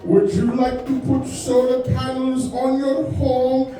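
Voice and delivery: slow mock Indian call center accent. Telemarketer pitching solar panels, mock Indian accent. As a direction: drag it out like a scripted sales call. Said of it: "speak in a worse accent".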